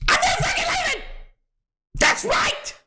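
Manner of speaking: shrill victory brag; hyped defiance. Real, not acted. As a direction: declare it like a winner who won't leave.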